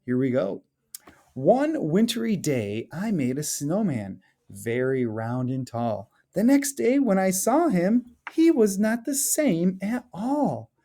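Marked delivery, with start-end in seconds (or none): 1.3-10.7 lively storybook read-aloud, rising at the end; expressive children's story narration. Acted. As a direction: read it like a picture book to the class. Teacher reads picture book aloud.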